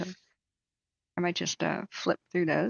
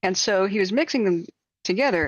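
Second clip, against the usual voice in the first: ordinary talk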